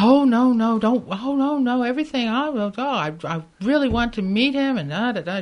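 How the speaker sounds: gushing imitation of an excited woman, high and loud; all excited. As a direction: tumble over yourself with eagerness, mimicking her. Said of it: "She was all excited".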